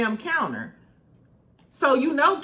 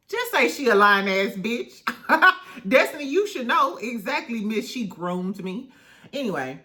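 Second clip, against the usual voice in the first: ordinary talk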